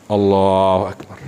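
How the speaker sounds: low, solemn takbir; praying worshipper. Shown as a demonstration. Preacher shows congregation how someone begins prayer, intoned takbir. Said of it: "misalnya orang sholat".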